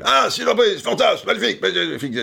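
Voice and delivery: Michel Drucker impression. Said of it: "j'imite très mal".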